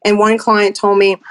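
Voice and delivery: ordinary delivery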